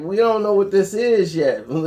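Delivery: normal talking voice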